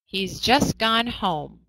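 slow, careful reading, every word spaced; slow example read. Shown as a demonstration. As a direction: read it out slowly for the class. Teacher reads example sentence slowly.

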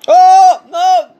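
loud, high rapid-fire no's; panicked outcry. Real, not acted. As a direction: wail in dismay, then rattle off no's. Game going against the player.

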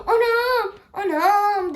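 high loud startled shouts; alarmed cries. Real, not acted. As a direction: yell in real alarm. Earthquake in the game.

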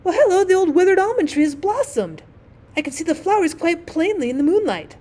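put-on high, bright boyish discovery; excited boy. A narrator's reading. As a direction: point it out eagerly.

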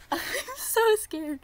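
high-pitched nervous squeal with a laugh; giddily scared. Real, not acted.

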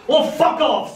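high squawky parrot swear; lightly voiced rude parrot. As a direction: screech it like a cheeky parrot. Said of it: "said the parrot".

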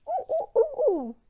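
very high, loud ooh ooh ooh monkey call; squeaky monkey hoots. Pretend. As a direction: hoot like a monkey. Monkey sound in the story.

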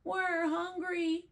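high, slow whining plea; children crying out. Acted. The twins to the grown-ups, a high, slow hungry whine. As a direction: sound like hungry little kids. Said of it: "cried the twins".

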